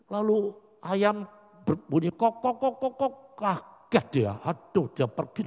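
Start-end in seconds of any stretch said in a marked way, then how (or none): 2.4-3.1 clucking rooster kok sounds; rooster crow imitation. Preacher imitates the rooster that made Peter weep, clucking.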